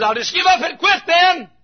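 high-pitched shout of giving in; acted high-pitched outcry; shouting back. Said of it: "Shallow shouts back and says".

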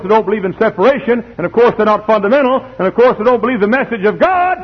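high, mounting pulpit list; preacher's scornful build; full-force preaching. Real, not acted.

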